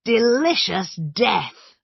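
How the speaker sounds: slow, loud, savouring cry; excited exclamation. A narrator's reading. Patrick naming the cake at table, excited. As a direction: relish the name of the cake. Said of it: "cried Patrick excitedly".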